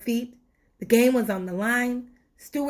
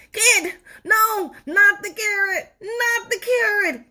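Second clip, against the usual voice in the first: high, louder, crying plea; panicked tooth; 12 semitones higher, 6 dB louder than usual. Acted. Tooth begs Stuart to spare it from the carrot, high crying panic. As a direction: plead in terror.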